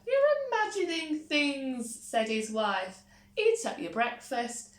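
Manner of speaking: high, loud dismissive; brisk wife. A narrator's reading. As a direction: brush him off firmly.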